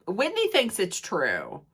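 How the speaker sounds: ordinary talk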